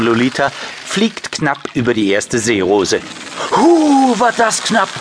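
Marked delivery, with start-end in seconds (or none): 3.5-4.9 much higher, startled exclaim; relieved gasp. Acted. Niki just scraped past the lily pad, high startled relief. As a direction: squeal at the near miss.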